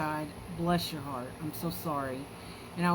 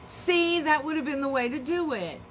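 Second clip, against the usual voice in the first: higher and louder, delighted; excited approval; 9 semitones higher, 5 dB louder than usual. Real, not acted. Host praises a mom's gentle words to listeners, excited. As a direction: burst out with delight.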